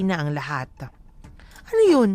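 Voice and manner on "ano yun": sudden loud, high scoff; indignant outburst. Real, not acted. Host scoffs at couples who skip talking, loud indignant outburst. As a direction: burst out in disbelief.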